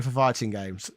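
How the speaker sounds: ordinary talk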